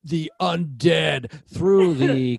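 high, slow, hammed-up spooky proclamation; mock ominous announcer. Friend parodying the seance plan as a grand announcement. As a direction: drag it out like a doom-laden tech ad. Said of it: "sounds so ominous and then futuristic".

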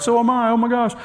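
very high, gushing imitation; mock excitement. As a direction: mimic an over-thrilled new acquaintance. Mimics stranger's excited reaction.

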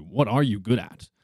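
ordinary delivery

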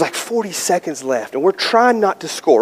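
ordinary delivery

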